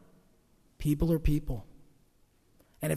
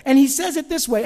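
rising, louder preaching build; 6 semitones higher, 6 dB louder than usual. Acted. Pastor rallying the church, preaching loudly. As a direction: preach it with fire to the congregation.

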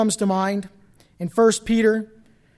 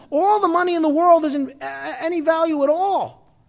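emphatic preaching; 11 semitones higher, 6 dB louder than usual. Real, not acted. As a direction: drive the point home in a raised voice.

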